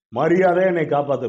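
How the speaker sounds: high, loud, imperious order; commanding demand. Acted. Satan orders God to save him, loudly commanding. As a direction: demand it forcefully, as if in charge.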